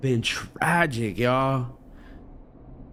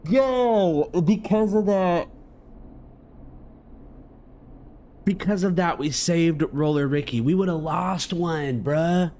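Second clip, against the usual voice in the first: excited; 4 semitones higher than usual. Real, not acted. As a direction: cheer about the rescue like you just pulled it off. Streamer celebrates saving a character.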